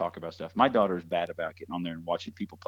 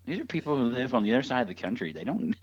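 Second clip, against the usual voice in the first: put-on higher, chattering excited kid; gushing kid voice; 5 semitones higher than usual. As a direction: imitate a gushing child. Imitating daughter's gushing.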